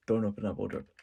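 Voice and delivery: menacing. Retold or imitated. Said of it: "In the most menacing way".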